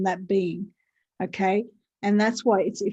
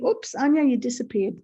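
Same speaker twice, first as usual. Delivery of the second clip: ordinary talk, nothing marked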